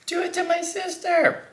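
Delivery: high squealing plea; mock the girl's excited scream; thrilled high-pitched request; retold excited squeal. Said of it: "she screamed".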